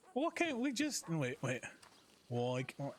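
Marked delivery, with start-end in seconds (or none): 0.1-1.1 attempted Irish accent. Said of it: "I can't do Irish".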